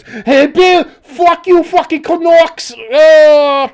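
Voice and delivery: put-on loud, high-pitched drunken fan yelling; hammed-up drunk Bostonian shouting abuse. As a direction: holler like a drunk Boston sports heckler. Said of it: "a drunk Bostonian that goes".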